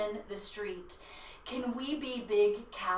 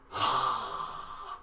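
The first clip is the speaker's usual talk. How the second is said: scary lizard hiss; 5 dB quieter than usual. Shown as a demonstration. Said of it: "let's be a scary lizard".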